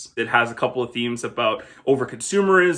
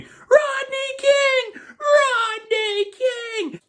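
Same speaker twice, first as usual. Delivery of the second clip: high, drawn-out mock scream; much slower than usual. Movie reviewer imitating Sinbad's line, high mock scream. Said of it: "he's screaming".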